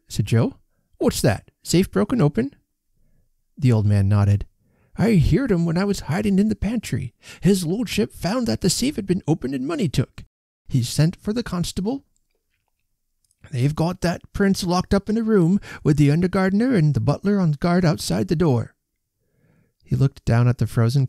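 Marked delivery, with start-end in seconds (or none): 1.0-2.5 surprised. A narrator's reading. Joe startled by the news. 4.9-18.7 put-on high, nervous old man; an old servant reporting in a hurry. A narrator's reading. Old man recounts the theft.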